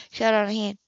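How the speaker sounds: ordinary delivery, nothing marked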